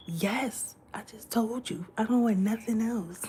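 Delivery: normal talking voice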